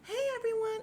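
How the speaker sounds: high-pitched imitation of a tipsy holler; mock drunk scream. Imitating a drunk guest greeting the party, mock scream. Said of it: "because she's screaming".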